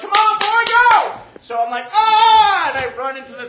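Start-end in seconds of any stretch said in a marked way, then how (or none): 0.0-1.1 high, full-volume hurrying yell; urgent shout. Retold or imitated. Crew rushing the late jumper, urgent high shout. 1.9-2.7 high, loud panicked-sounding yell; acted yell. Rushed jumper running into the thing, mock loud yell. His acted cry as he runs.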